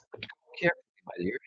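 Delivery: hushed unvoiced warning; whisper. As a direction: whisper it so nobody hears. Warning to be quiet in cellar.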